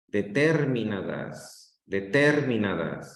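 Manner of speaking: "Determinadas" lento, con el acento en TER: deTÉRminadas. Shown as a demonstration. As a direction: alarga y carga TER en "Determinadas". ("Determinadas" con el golpe de voz en TER)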